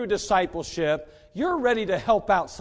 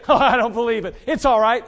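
mock dismissive; 4 semitones higher, 10 dB louder than usual. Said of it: "And you say".

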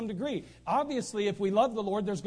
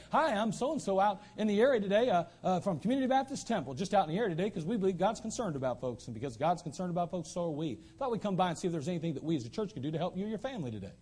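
rattled-off doorstep spiel; 2 semitones lower, faster than usual. Retold or imitated. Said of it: "but you'd say".

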